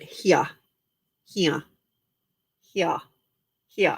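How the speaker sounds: "Here." said as "hee-ah" with no r; trying an Aussie vowel. Shown as a demonstration. Testing how Natasha says it, drawing out "hee-ah". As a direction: stretch "Here." into two vowels, "hee-ah", dropping the r. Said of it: "Is that how Natasha says it?".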